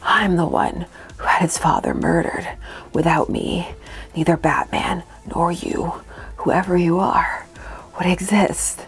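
low whispery cartoon villain voice; slow theatrical villain menace. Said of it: "more of a mwahaha Saturday morning cartoon villain".

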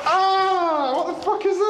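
high startled yelp at a spider; startled shriek. Real, not acted. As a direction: shriek at something sudden. Sees a spider.

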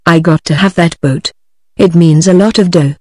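usual speaking voice

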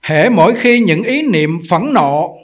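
loud, slow, declaiming read of scripture; reading the master's text aloud. Real, not acted. Monk reading Master Yin Guang's words to the assembly, loud and slow. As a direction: proclaim the teaching to the hall. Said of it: "chúng tôi đọc quý vị nghe".

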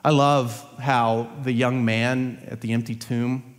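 usual speaking voice

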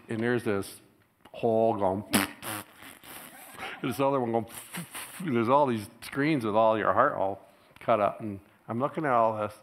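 ordinary delivery

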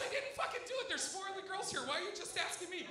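very high, squealed mock protest; imitated accused girl. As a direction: protest frantically in a high girl's voice. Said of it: "She goes".

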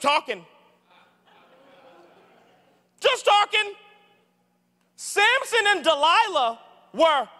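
high and loud, driving every point home; full-force preaching. Acted. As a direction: preach it to the back row.

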